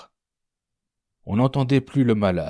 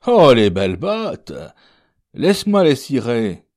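loud, high-swooping admiration; admiring exclamation; 5 semitones higher, 8 dB louder than usual. Acted. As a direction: exclaim loudly over the fine boots. Coachman exclaims over the new boots. (exclamation admirative, haute et forte)

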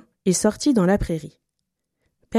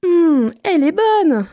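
slow, high and louder duck voice, savouring; delighted duck; 10 semitones higher, 5 dB louder than usual. A narrator's reading. Duck plunging into the pond, delighted and high. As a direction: relish the water like a happy duck.

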